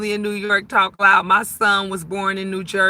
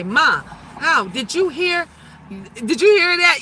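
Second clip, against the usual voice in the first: higher-pitched mock child's question; imitating amazed kids; 5 semitones higher than usual. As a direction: lightly voice an astonished kid. Voicing her amazed children.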